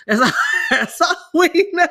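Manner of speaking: bursting out laughing, high and loud. Real, not acted. Mom laughing at the teacher's note, loud laughing. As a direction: laugh through the words.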